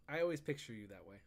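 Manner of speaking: normal talking voice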